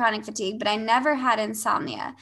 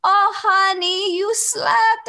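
much higher, louder, slow joyful cry; overjoyed; 10 semitones higher, 6 dB louder, slower than usual. Retold or imitated. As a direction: beam with delight for her. German lady overjoyed she slept.

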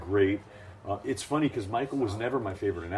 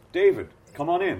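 much higher, louder hearty welcome; warm welcome; 11 semitones higher, 9 dB louder than usual. Retold or imitated. Announcer greeting David warmly, hearty and loud. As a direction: call him in with a big friendly boom. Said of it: "he turns to me and goes".

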